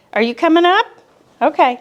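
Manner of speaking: high, bright coaxing voice for the dogs; pet talk. Real, not acted. Speaker coaxing her jealous dogs, high cooing pet talk.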